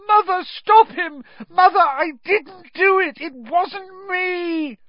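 frantic, very high pleading; screaming. A narrator's reading. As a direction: scream your innocence, stretching the last cry. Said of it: "screamed the boy below".

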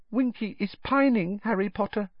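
sad, very high elf voice; hushed, sorrowful little house elf; Dobby. A narrator's reading. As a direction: confide it sadly in a small squeaky voice. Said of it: "Dobby whispered sadly".

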